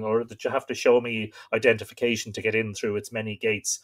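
ordinary talk, nothing marked

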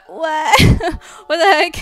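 high, loud laughing outburst; amused, incredulous yelp; laughing disbelief. Real, not acted. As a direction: blurt it out through a laugh. Laughing disbelief at French message.

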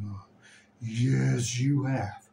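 ordinary delivery

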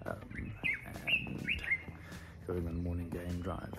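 hushed early-morning whisper. Real, not acted. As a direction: whisper so as not to wake anyone.

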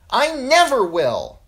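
put-on high, loud, drawn-out boyish yell; little boy shouting a vow; boy's yell. A narrator's reading. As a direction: yell your defiance slowly and high. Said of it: "yelled the little Derry boy".

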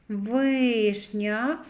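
Вишня with a long stretched И; drawn-out И. Shown as a demonstration. Teacher picks out the vowel, И drawn out. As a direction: hold the И in Вишня. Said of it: "и голосний".

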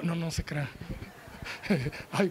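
normal talking voice

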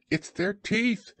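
high, slow little voice; small creature, slow and in earnest; earnest Piglet. A narrator's reading. Piglet worries about fierce creatures, earnest and high. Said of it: "said Piglet earnestly".

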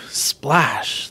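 plain reading voice

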